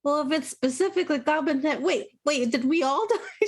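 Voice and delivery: revived goblin working out if she died; confused goblin girl. Acted. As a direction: rattle off the questions in a bewildered rush.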